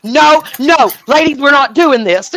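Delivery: the DJ cutting in to stop a fight; DJ stepping in. Retold or imitated. DJ breaking up the women.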